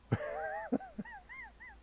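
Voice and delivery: a squeaky giggle after the joke; high giggle. Real, not acted. Vlogger laughing at his own preacher bit, high giggle.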